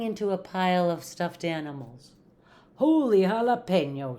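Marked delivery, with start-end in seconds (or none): 2.8-4.2 loud, high storybook exclamation; exclaiming. A narrator's reading. Kitten lands in the stuffed animals, exclaiming. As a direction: burst out in mock astonishment. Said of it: "exclaimed Skippy John Jones".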